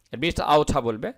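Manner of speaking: ordinary delivery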